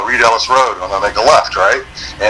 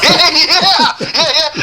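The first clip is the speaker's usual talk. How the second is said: high laughing agreement; laughing yeah yeah; much slower than usual. Real, not acted.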